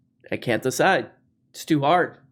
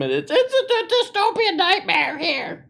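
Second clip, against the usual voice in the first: Jordan Peterson impression. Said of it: "And Jordan Peterson".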